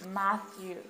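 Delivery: "Matthew" said MATH-yoo, for a learner to copy; "Matthew" in American English, tongue on the TH; English pronunciation. Shown as a demonstration. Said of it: "ça va se lire Matthew".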